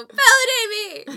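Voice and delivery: yelling. Retold or imitated. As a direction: shout it high and loud. Yelling at partner across house.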